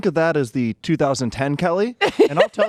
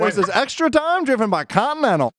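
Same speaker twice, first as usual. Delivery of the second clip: put-on old-timey radio voice; 6 semitones higher than usual. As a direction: announce it like a 1940s radio host. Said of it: "the old-timey radio voice".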